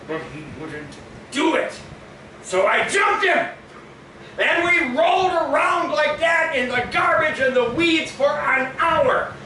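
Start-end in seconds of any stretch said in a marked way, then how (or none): 1.3-9.3 higher, loud frantic storytelling; excited shouting. Retold or imitated. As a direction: shout the fight out breathlessly. Retelling a wild brawl.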